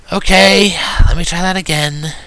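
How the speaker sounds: high, loud tomboy pony voice; brash high pony voice restarting; Rainbow Dash voice. A narrator's reading. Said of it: "she requested".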